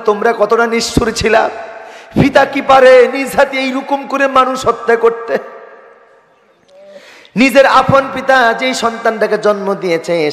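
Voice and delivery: high, ringing preacher's rebuke; impassioned reproach. Acted. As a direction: question the father in a soaring sermon voice. (উঁচু গলায় ওয়াজের সুরে তিরস্কার)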